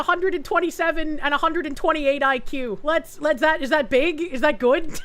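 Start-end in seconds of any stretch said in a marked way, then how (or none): none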